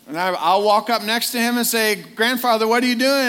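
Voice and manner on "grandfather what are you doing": put-on bright, higher, louder hello to an old friend; calling out happily, pitch raised; delighted greeting. Preacher greeting grandfather in heaven, delighted and higher.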